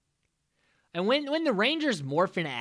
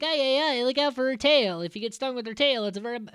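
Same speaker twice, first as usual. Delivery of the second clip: higher, hammed-up Italian accent; 8 semitones higher, faster than usual. Alpha warning Rangers about the tail, mock Italian accent. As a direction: ham up a fretting Italian accent. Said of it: "I don't know why I went Italian with that".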